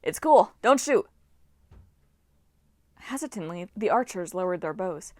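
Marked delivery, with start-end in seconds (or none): 0.0-1.1 loud reassuring shout; yelling. A narrator's reading. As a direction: shout to calm them down. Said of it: "and Leo yelled".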